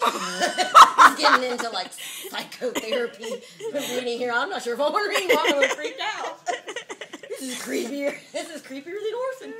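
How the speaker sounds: loud, laughing through the words; can barely read for laughing; laughing hard. Real, not acted. Reader cracking up at the book.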